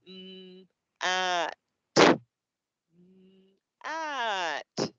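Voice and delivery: held "Mm" and "ah", short "t-", gaps between; separate sounds. Shown as a demonstration. Teacher repeating the sounds so other kids hear, slow and segmented. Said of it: "only gonna say the sounds".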